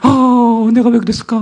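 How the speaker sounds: imitating remorseful daughter. Daughter to father, high regretful child voice. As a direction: wonder aloud like a sorry child.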